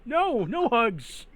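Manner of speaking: high, loud, drawn-out protest; panicked refusal. Acted. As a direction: beg it off in a slow wail. Son refuses the slime's hugs.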